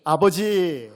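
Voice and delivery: second loud call of 아버지; calling father again aloud. Shown as a demonstration. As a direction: call "아버지" out with all your heart. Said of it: "아버지라고 한번 불러보겠습니다".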